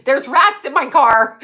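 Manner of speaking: a lightly voiced brain yelping about rats; mock scatterbrained inner voice. Mocking her own scattered brain, a loud yelp. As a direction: blurt it like a panicked thought. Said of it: "my brain's like".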